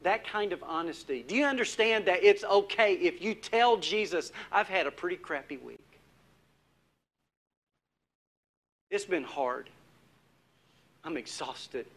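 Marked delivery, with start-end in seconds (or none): 4.5-11.9 weary confession. Retold or imitated. Confessing a hard week to Jesus.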